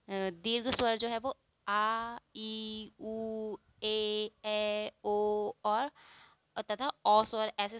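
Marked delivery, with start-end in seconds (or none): 1.7-5.5 each vowel held long on an even note. Shown as a demonstration. Teacher showing vowels that take double time, long vowels. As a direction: draw out आ, ई and उ, one by one. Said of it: "हर्ष स्वर से दुगुना समय लगता है".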